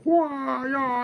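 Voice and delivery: high mock kung fu cries "Wah, yah"; playful martial arts shouts, pitched high; kung fu yells. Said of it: "he hears me going".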